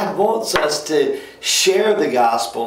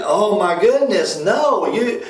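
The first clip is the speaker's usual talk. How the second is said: higher, aghast protest rising to a peak; exasperated disbelief; 7 semitones higher than usual. Retold or imitated. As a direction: sound appalled by the idea, voice jumping up. Pastor exasperated at complacent attitude.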